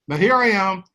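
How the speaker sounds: ordinary talk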